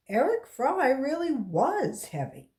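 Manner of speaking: plain reading voice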